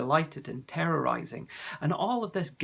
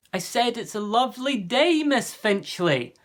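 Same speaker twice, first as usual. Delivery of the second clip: a little louder; 8 semitones higher, 7 dB louder than usual. A narrator's reading. Jonathan repeats his greeting louder to old Miss Finchley. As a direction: speak up for a deaf ear. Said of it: "a little louder in case she was going deaf".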